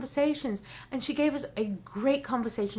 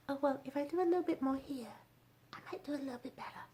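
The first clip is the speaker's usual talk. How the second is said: very soft, fading into a whisper; timid small voice; 13 dB quieter, faster than usual. Acted. Speaker mocks doing just a little bit, timid and hushed. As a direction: shrink it down to barely audible.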